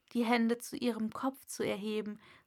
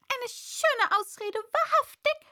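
high, loud scornful pigeon; scoff, jumping up on the last word; contemptuous bird voice; deepest contempt; 13 semitones higher, 6 dB louder than usual. A narrator's reading. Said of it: "im Tone tiefster Verachtung".

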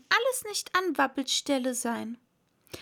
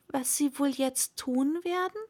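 ordinary delivery

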